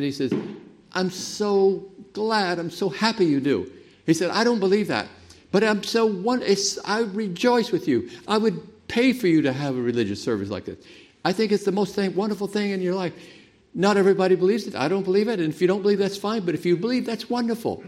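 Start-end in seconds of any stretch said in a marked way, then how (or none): 0.9-13.1 high, sing-song mock of a pastor; lightly voiced gushing chaplain, pitched up; mocking liberal chaplain. Preacher mocking a Navy chaplain who denies the resurrection, gushing high voice. 13.7-17.8 high, sing-song mock of a pastor; lightly voiced gushing chaplain, pitched up; mocking liberal chaplain. Preacher mocking a Navy chaplain who denies the resurrection, gushing high voice.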